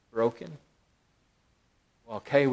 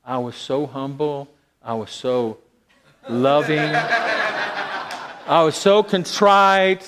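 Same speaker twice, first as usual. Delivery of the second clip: sarcastic, laughing, stretched-out contrite; mock humble; 4 semitones higher, 5 dB louder, much slower than usual. Preacher mocking his own pretended humility, laughing and drawn out. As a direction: lay the fake humility on thick with a laugh. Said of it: "Boy, did something happen".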